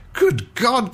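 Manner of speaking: Good God cried high in alarm; a shocked exclamation at the sight; startled cry. A narrator's reading. Captain finds a sailor smoking in the powder magazine, alarmed cry. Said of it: "cried the captain".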